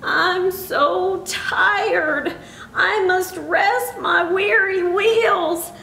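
high, very slow, drawn-out tired engine; weary, sighing old engine. A narrator's reading. Old engine refusing the toys, wearily sighed. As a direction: drag every word out as if worn out. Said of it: "rusty old engine sighed".

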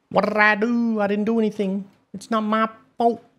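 high-pitched imitation of a protester; mocking whine. Admin imitating the typical banned player, mocking whine. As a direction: mimic a whiny plea of innocence up high.

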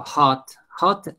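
"hot" with an audible H; teacher's demo of "hot", H sounded. Shown as a demonstration. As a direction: pronounce the H at the start of "hot". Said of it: "la H tiene sonido".

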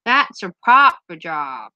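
broad Norfolk vowels, drawn out; proper Norfolk accent. Shown as a demonstration. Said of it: "that's how Norfolk people talk".

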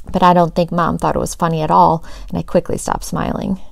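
ordinary delivery, nothing marked

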